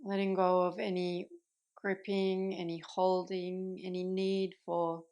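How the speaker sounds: calm meditation guide with long pauses between phrases; unhurried, settled voice, easing listeners into rest; guided meditation. Acted. Yoga teacher guiding listeners into Yoga Nidra, calm guided meditation.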